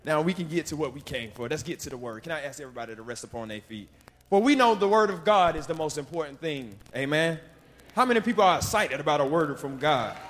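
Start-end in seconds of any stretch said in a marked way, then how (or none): 4.3-10.2 loud, rising call to the congregation; preaching at full force. Acted. Preacher rousing the congregation to stand, loud preaching. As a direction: fire up the church for the word.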